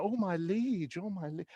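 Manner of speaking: mock period-romance sigh, tailing away; period drama character. Mocking racy period drama to audience, soft swooning. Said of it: "these sort of racy period things".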